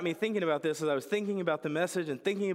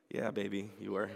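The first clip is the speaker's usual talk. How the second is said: deadpan dad reply; 9 semitones lower, 6 dB quieter than usual. Pretend. Dad's deadpan reply to daughter.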